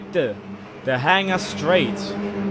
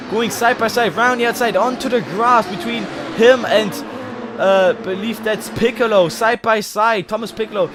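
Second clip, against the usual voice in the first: raised, quick live race commentary; motorsport commentator; 8 semitones higher, 6 dB louder than usual. Real, not acted. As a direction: call the race as it happens.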